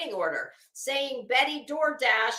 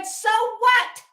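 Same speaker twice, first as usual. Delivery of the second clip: high, loud so what, pushing back hard; tossing off a challenge, louder and higher; defiant retort; 8 semitones higher, 5 dB louder than usual. Retold or imitated. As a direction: fire back with scornful defiance. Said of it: "to which I said".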